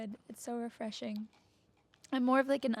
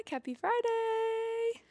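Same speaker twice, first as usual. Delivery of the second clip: sing-song greeting; 12 semitones higher, much slower than usual. Real, not acted. Cheerful show greeting.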